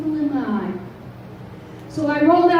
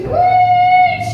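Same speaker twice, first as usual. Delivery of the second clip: shouted preach; 6 dB louder than usual. Real, not acted. Shout of encouragement.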